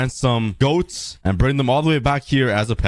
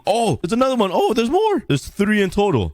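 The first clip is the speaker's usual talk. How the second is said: high, thrilled, spotting more; excited surprise; 10 semitones higher than usual. Real, not acted. Finding more goats.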